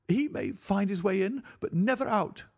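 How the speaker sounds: high woman's voice in fierce glee; fierce, exultant cry. A narrator's reading. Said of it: "she cried".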